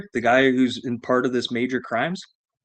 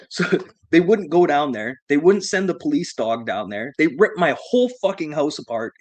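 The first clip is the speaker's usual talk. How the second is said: usual speaking voice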